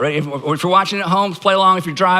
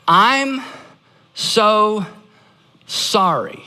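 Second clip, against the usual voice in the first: word by word, long pauses between; a slow, deliberate apology, each word standing alone; model apology; much slower than usual. Shown as a demonstration. Pastor showing the congregation how to apologize, slow and deliberate. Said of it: "It sounds like this".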